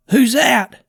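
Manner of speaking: put-on high, loud frightened old man; a scared old man's sharp high cry; high startled call. A narrator's reading. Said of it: "the old man asked".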